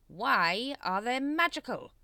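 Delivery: slow, loud, high questioning; drawn-out menacing impatience; exasperated demand. A narrator's reading. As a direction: spell out the question to a fool. Grand Master interrogates member.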